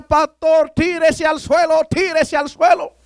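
orden gritada, aguda y alargada; grito imitado. Retold or imitated. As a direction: imita a quien grita una orden desde lejos, lento y agudo. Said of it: "él iba gritando". (grito de aviso imitado, agudo)

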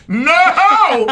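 big high-pitched mock outcry; retold loud no. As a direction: belt it out in imitation, loud and high. Retelling her outburst at table read.

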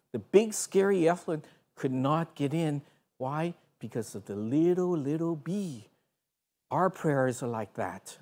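a soft, tender Bible lesson; gentle sermon. Acted. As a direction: reassure the children quietly.

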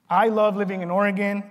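ordinary delivery

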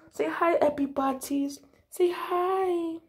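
soft cutesy coaxing; gentle cooing to a pet. Real, not acted. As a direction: coax the cat to greet viewers, softly. Coaxing cat to greet viewers.

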